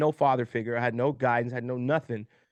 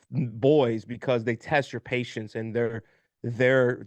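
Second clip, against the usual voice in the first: ordinary talk, nothing marked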